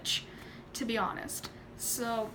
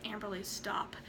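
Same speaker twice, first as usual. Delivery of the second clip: much softer, low reprimand; hushed warning; 4 semitones lower, 11 dB quieter than usual. Retold or imitated. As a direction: imitate a mom quietly reining in her child. Mom quietly reins her in.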